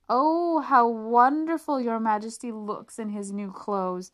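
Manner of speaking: overdone fawning praise; nobles crying out. Acted. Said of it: "cried all the nobles".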